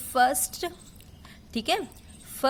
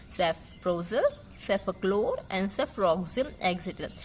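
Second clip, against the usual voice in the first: very slow, each syllable clear; slow drug names; 4 semitones lower, much slower than usual. Shown as a demonstration. Teacher repeats drug names slowly for class. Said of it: "जैसे मैं pronounce करती हूँ वैसे".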